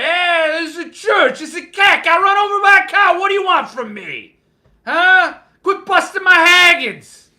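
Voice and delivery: high, loud, mangled King reading voice; Stephen King impression. King reading his own book aloud, loud hammed-up impression. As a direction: bellow it like King reading Pet Sematary. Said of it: "Your King impression is spot on".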